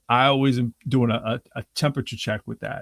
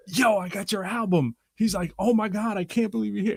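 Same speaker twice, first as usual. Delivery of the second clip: excited fan; 9 semitones higher than usual. Retold or imitated. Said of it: "he goes".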